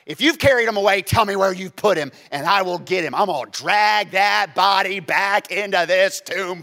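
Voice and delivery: loud, demanding threat; demanding Mary. Acted. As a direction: insist on getting the body back. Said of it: "she says to him".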